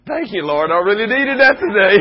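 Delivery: high, loud cry of thanks upward; hammed-up relieved husband praising God; grateful shout.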